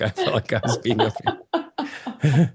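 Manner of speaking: giggling through the line; laughing. Real, not acted. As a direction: laugh at yourself as you say it. Laughing at her own fairy comparison.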